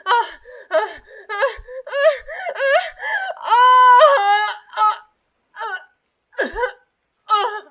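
breathy high moaning pulses; rhythmic moans. Acted. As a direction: moan on every breath.

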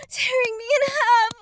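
usual speaking voice

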